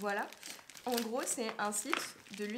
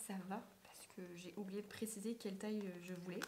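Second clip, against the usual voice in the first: whispering; 2 semitones lower, 7 dB quieter than usual. Real, not acted. Keeps whispering after ASMR moment.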